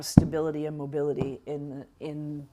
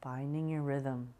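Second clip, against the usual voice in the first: guided meditation; 5 dB quieter than usual. Acted. Guides a slow breathing meditation.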